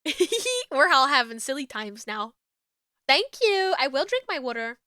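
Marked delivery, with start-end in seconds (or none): 0.0-0.6 very high squeal of joy; squeaky yippee. Real, not acted. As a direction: squeal it gleefully. Silly celebration.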